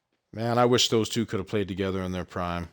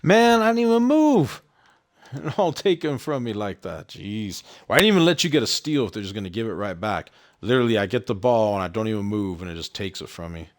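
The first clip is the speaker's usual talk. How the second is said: frustrated outburst, fading to usual pitch; real frustration. Real, not acted. Gamer complains the ball got taken from him, frustrated. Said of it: "Sorry if I sound frustrated".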